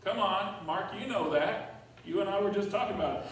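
ordinary delivery

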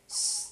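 long soft "shh"; shushing. Acted. Rat hushing the gang near the wolves, shushing. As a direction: hush them with a drawn-out shh.

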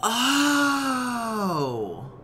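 high, loud, drawn-out "oh" of revelation; real burst of surprised understanding; delighted realization. Real, not acted. Streamer finally getting the French explanation, loud delighted realization.

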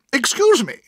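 high, loud interruption; sharp. A narrator's reading. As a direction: cut in sharply. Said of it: "said my aunt sharply".

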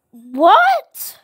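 loud rising shocked cry; shocked what. Real, not acted. Gamer sees something alarming, shocked what. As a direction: blurt it in total shock.

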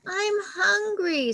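high, slow whimpering plea; crying hungry chick. Acted. Confetti pleading to the monkey, high and tearful. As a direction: whimper it like a chick near tears.

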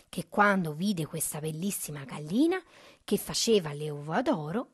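plain reading voice

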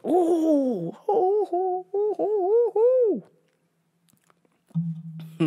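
excited. Real, not acted. Excited at new headline.

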